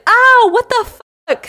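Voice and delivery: high, loud yelp of pain; mock scream. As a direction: yelp in outrage after a knock on the head. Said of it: "So he's screaming".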